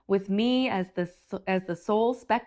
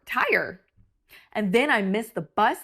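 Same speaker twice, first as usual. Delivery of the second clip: ordinary delivery, nothing marked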